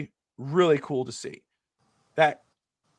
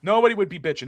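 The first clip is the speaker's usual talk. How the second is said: ordinary talk, nothing marked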